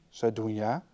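ordinary delivery

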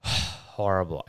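stuffy, blocked-nose voice; talking with a head cold; nasal sick voice. Real, not acted. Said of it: "nasally asshole".

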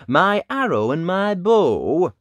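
loud, high lilting question; Dad asking. A narrator's reading. Dad asking Ned for his bow, loud hearty voice. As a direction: call it out with big swoops. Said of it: "he asked".

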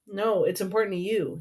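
ordinary delivery

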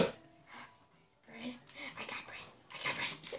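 hushed, voiceless play whisper. Pretend. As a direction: whisper it secretively, barely any voice. Panther offered bread in roleplay.

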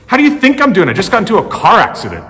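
high, loud, exasperated retort; angry caller. Retold or imitated. An upset driver yelling at the adjuster, angry and loud. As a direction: snap back like a fed-up crash victim.

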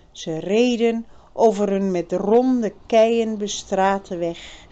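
usual speaking voice

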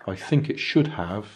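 normal talking voice

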